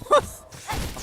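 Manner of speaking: airy high-pitched giggle; high breathy laugh. Real, not acted. Laughs at dark joke.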